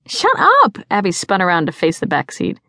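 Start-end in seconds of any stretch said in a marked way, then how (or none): 0.1-0.8 very high, loud protest; indignant outburst. A narrator's reading. Sister silencing friends teasing about her brother, indignant. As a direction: cut them off in outrage.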